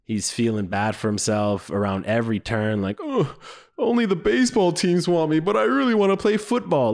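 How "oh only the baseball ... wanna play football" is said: high-pitched self-pitying whine; hammed-up tearful complaint; mock crying. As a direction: imitate a kid crying for himself. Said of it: "is just crying".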